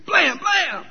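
two high-pitched vocal bangs; punchy, high "blam, blam"; imitated double gunshot. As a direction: mimic two rifle shots. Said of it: "he hears".

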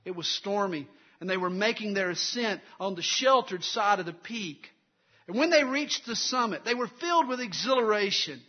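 loud, high-pitched pulpit storytelling; energetic preaching. Real, not acted. Preacher telling the congregation of a storm on the summit, energetic preaching. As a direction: build the drama of the climb in full voice.

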